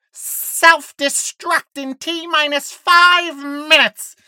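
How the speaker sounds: high, slow, screeching metallic announcer voice; a metallic intercom voice screeching the countdown. Acted. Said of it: "a metallic voice screeches over the intercom".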